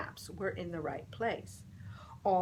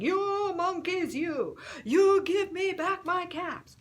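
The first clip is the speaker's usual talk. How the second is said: high, loud angry scolding; angry peddler; 11 semitones higher, 8 dB louder than usual. Acted. Angry peddler shouting at monkeys in the tree. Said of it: "This made the peddler angry".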